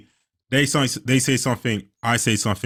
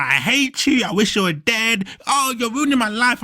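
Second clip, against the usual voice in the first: mock kid tantrum; 9 semitones higher than usual.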